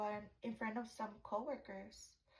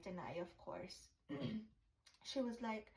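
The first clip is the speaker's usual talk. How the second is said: normal talking voice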